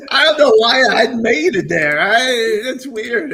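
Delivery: baffled and cracking up; laughing. Real, not acted. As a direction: talk through a big laugh. Cracking up at own poem.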